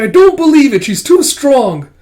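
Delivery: high, loud cry of disbelief; desperate denial. Acted. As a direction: shout it out, refusing to accept it. Kippa refuses the bad news.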